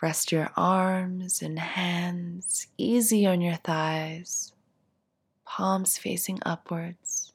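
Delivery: calm, unhurried meditation voice with long pauses; guided meditation. Acted. Teacher guiding seated listeners through a body scan, guided meditation. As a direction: ease them gently through each instruction, leaving space to settle.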